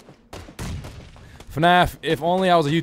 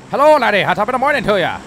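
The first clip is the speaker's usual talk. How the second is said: loud, high mock Irish greeting; Irish shout; 7 semitones higher, 8 dB louder than usual. Streamer greeting players, loud mock Irish cry. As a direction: holler it like a hammed-up Irishman.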